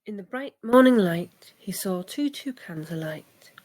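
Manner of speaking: usual speaking voice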